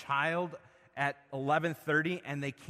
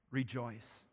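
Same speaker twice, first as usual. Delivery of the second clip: Rejoice said much softer, falling away; 4 semitones lower, 7 dB quieter than usual. Acted. Preacher giving Paul's answer to the church, said softly. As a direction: drop to a soft Rejoice that falls at the end.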